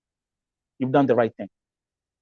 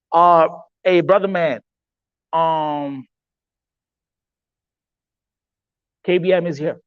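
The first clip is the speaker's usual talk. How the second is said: excited greeting; 5 semitones higher, 5 dB louder, slower than usual. Real, not acted. Greets guest joining stream.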